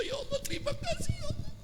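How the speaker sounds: high, breaking sob of thanks; crying prayer. Acted. Sheikh weeping with gratitude, high and crying. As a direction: weep as you thank God.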